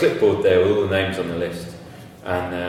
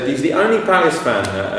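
ordinary talk

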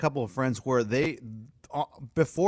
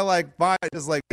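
ordinary talk

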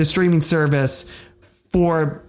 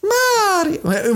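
squeaky high-pitched Mario. Shown as a demonstration. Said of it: "in the high voice".

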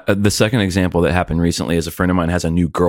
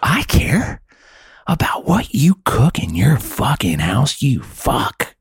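high, slow, furious whisper; whispered rage at a vegan drummer; angry whisper; 6 semitones higher, slower than usual. Retold or imitated. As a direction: hiss it like a private fantasy rant. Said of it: "But I'm like".

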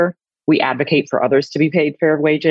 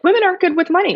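mocking; faster than usual. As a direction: say it like a silly cliché. Mocking a common claim.

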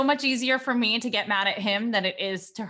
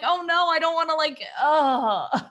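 mock distress; 6 semitones higher than usual. Mom retells feeling bad.

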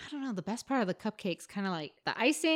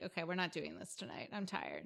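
tired; 2 semitones lower, 12 dB quieter than usual. Retold or imitated. Imagined giving up on the date.

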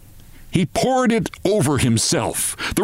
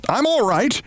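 high yell to reassure; reassuring yell; 9 semitones higher than usual. A narrator's reading. Briggs yelling to reassure the mother, high yell. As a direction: yell it out to calm her. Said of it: "Briggs yelled to reassure her".

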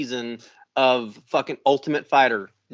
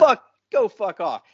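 sharp, angry telling-off; 4 semitones higher, 4 dB louder than usual. Retold or imitated. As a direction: snap it out angrily.

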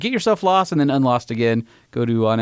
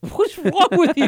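exasperated; slower than usual. Real, not acted. Playful exasperated jab at cohost.

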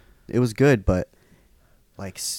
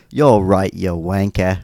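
mock British; 7 dB louder than usual. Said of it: "I'd be like".

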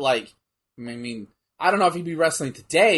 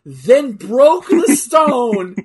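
amazed emphasis; 4 semitones higher, 8 dB louder, much slower than usual. Real, not acted. Describing absurd punch.

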